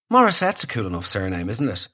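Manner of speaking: ordinary talk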